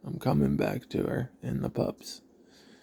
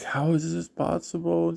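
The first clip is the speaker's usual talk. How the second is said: higher-pitched imitation of distraught disbelief; retold cry of a mother's dismay, pitched up; tearful mom; 7 semitones higher than usual. Mom at the hospital hearing the bad news, imitated as tearfully upset. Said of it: "She starts crying".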